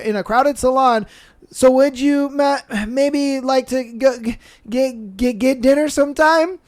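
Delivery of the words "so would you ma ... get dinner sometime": nervous stammering ask; stuttering. Retold or imitated. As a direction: stumble nervously over every word. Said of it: "Yes, those are stutters".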